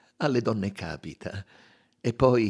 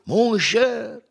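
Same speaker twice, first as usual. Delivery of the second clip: much higher, louder, slow admiring cry; exclaiming; 8 dB louder, much slower than usual. A narrator's reading. Monsieur Buck crying out in admiration of his friend, excited. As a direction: burst out in delight. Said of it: "gridò".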